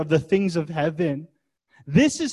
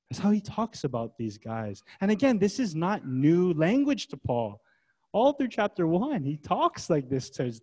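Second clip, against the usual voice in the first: ordinary talk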